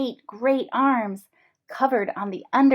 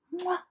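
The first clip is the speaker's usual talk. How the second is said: 1 kiss sound; 9 semitones higher, 6 dB quieter than usual. Acted. Fish plants a kiss.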